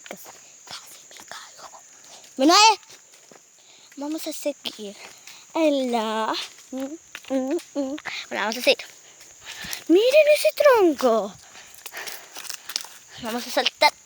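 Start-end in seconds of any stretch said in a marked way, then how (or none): 0.1-1.7 breathless whisper. Real, not acted. Whispered after near fall. 9.9-11.3 high and slow, delighted; excited. Real, not acted. Pointing out a log.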